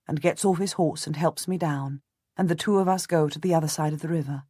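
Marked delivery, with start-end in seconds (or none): none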